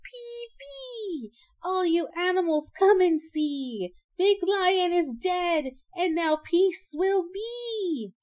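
high, drawn-out herald's shout; trumpet-like call, then loud slow announcement; shouted proclamation. A narrator's reading. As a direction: proclaim it to a far crowd, stretching the words. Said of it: "blew a trumpet, and shouted".

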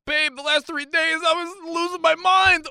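high, strained confession to a partner; mock desperation. Husband pretending to his wife he struggled, high mock desperation. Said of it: "Should I be like".

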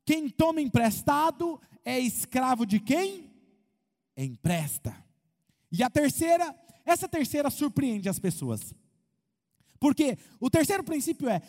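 ordinary talk, nothing marked

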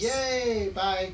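put-on high, loud happy kid; cheering child.

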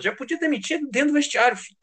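high, fast, fed-up outburst; exasperated. Real, not acted. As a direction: blurt it out, fed up. Ranting about coach after loss. (indignado e exaltado)

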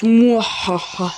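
slow, drawn-out evil villain laugh. Acted. Reading the dark lord's lines, an evil laugh. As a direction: cackle like a cartoon dark lord.

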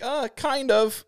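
high, drawn-out uncertain reply; hesitant. Retold or imitated. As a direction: imitate an unsure seller, slowly and high. Acting out hesitant merch seller.

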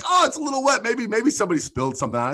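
high mock innocent voice; mock casual excuse; quoting the guy. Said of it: "And he's like".

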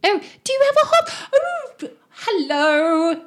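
very high, loud feminine voice; excited falsetto hail from below; woman calling back. Acted. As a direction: call up in a put-on high voice. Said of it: "She shouts".

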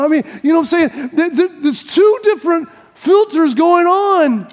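ordinary talk

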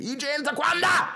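put-on loud, very high Belizean-accented summons; dad's Belizean accent. Dad calling fighting kids in, high Belizean-accented shout. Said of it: "he called us in there".

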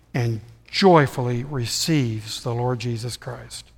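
slow, low, soft sermon delivery; reverent preaching. Real, not acted. Preacher showing congregation that Jesus saves sinners, hushed and reverent. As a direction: preach it softly, low and unhurried, with weighty pauses.

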